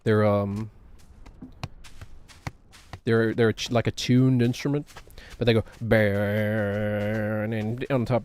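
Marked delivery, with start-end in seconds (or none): 5.4-8.2 slow droning hum on the words; bagpipe drone imitation. Streamer explaining bagpipes to chat by droning like one. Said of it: "Bagpipes have that drone underneath it".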